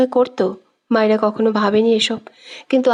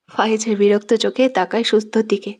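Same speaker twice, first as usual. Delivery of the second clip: usual speaking voice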